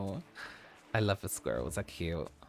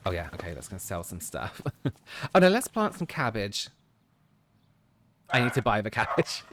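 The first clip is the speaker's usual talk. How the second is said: ordinary talk, nothing marked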